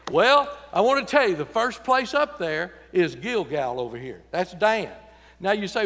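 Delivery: ordinary talk, nothing marked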